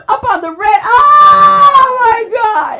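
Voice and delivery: wailing tenant begging the landlord in despair; anguished wail. Retold or imitated.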